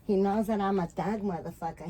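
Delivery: ordinary talk, nothing marked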